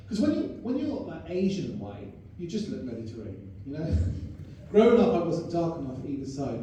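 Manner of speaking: ordinary talk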